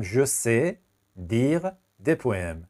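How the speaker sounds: each word drawn out slowly; slow teacher dictation. Shown as a demonstration.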